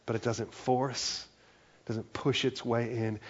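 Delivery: usual speaking voice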